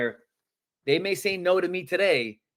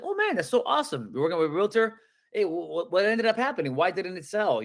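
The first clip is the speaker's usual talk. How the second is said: bright rising delight at the news, much higher; excited; 4 semitones higher than usual. Shown as a demonstration. Coach demos an upbeat reply to rejection, excited. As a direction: sound thrilled about their realtor. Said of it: "can you guys please sound excited".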